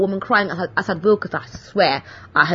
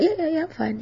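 brushing it off; 9 semitones higher, 6 dB quieter, faster than usual. Retold or imitated. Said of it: "I was like".

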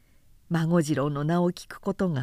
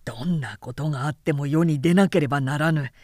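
lower, firm and louder brother; determined samurai vow; 3 semitones lower, 4 dB louder than usual. Acted. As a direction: swear it with grim resolve. Brother vows to rise.

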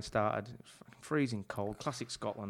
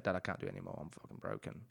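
broken confession; 5 semitones lower, 11 dB quieter, faster than usual. Retold or imitated. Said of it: "met my dad ... and said".

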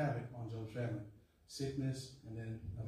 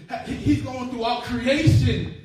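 preaching climax; 11 semitones higher, 12 dB louder than usual. Real, not acted.

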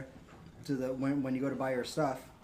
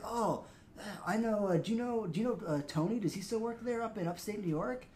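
higher, chummy store-manager voice; mock manager; 7 semitones higher than usual. Manager quizzing a fake employee, higher mock-friendly voice. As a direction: play the nosy manager checking up on you.

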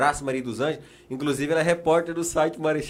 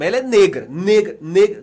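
negra repeated, louder each time; emphatic repetition; 2 semitones higher, 7 dB louder than usual. Real, not acted. Paulo jokingly insists to his friends, repeating negra emphatically. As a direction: hammer the word negra home.

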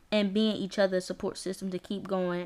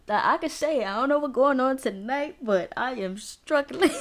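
amused exasperation; 5 semitones higher, 5 dB louder than usual. Real, not acted. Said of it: "laughing at her struggle".

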